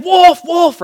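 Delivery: loud, high-pitched cry of wolf; hammed-up panicked shout to the town; mock alarm cry. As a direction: yell it like a boy faking an emergency. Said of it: "So he does it again".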